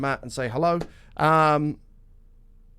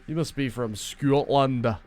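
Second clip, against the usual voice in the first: slow, hammed-up Scottish accent, the first words loud; a deliberately terrible Scottish accent; bad Scottish accent; 3 semitones lower, 4 dB louder, much slower than usual. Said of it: "the worst Scottish accent ever".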